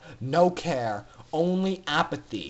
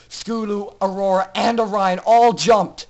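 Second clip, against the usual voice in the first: ordinary delivery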